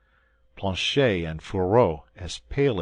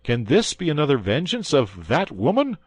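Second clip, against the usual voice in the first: alarmed; 9 semitones higher, 7 dB louder than usual. A narrator's reading. Alarmed at suspected poisoning.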